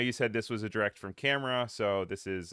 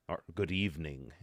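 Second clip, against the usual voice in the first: low, soft mock vampire greeting; a lightly hammed Dracula "good evening"; sultry spooky vampire welcome; Dracula voice attempt; 3 semitones lower, 5 dB quieter than usual. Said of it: "Was that a British accent?".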